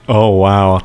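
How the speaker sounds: ordinary talk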